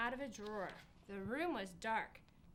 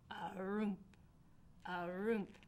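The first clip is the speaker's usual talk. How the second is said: a heavy, hollow roomp, roomp; lightly mimicked ghost steps; ghost's clunky footsteps; slower than usual. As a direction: thump it out like a creaky ghost walking. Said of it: "like that".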